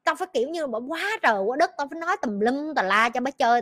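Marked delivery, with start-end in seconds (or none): none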